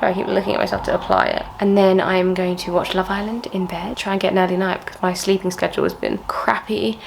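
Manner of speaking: ordinary talk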